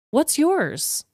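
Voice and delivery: ordinary talk, nothing marked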